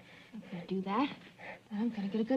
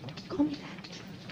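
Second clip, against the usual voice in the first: whispering; 6 semitones higher, 3 dB quieter, much slower than usual. Acted. Annoyed nurse, unvoiced.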